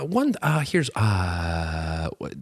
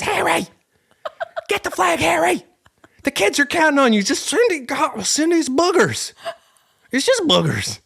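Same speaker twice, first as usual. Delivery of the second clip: much higher whiny voice urging Harry on; high-pitched mock mom. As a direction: shriek at Harry like a frantic game-show mom.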